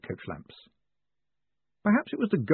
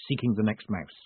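ordinary delivery

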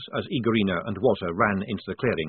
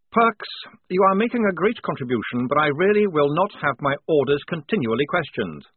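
high, firm lieutenant voice; exasperated officer; 6 semitones higher, 4 dB louder than usual. A narrator's reading. Lieutenant rebuking Polly for questioning orders, exasperated. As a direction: rein in a subordinate with formal reproof.

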